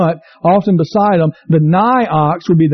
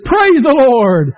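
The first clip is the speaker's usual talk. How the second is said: joyful praise; 6 semitones higher, much slower than usual. Retold or imitated. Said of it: "throws his hands up in the air and says".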